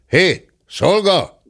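shouting for the servant; calling out. A narrator's reading. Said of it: "kiabálta".

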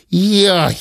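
high, loud jolted "jaj"; startled cry. Acted. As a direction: yelp as if stung. Stung by a thought.

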